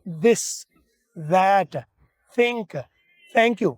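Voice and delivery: "this, that" with the upper teeth resting on the tongue; "think, thank you" with air blown out over the tongue; demonstrating TH, teeth on the tongue. Shown as a demonstration. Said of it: "जुबान के ऊपर मैंने दांत रख लिए".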